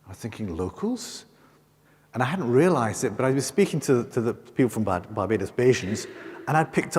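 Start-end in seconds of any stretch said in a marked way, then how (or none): 0.6-1.3 soft, high-rising echo question; puzzled disbelief. Retold or imitated. Guest mistaken for a local, puzzled disbelief. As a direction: query the word as if baffled, pitch leaping up.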